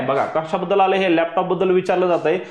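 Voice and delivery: normal talking voice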